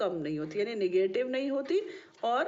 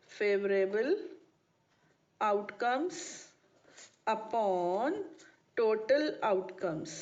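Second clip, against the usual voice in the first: very drawn-out words, rising at the ends; slow dictation; much slower than usual. Real, not acted. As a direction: dictate word by word with pauses. Dictating formula word by word.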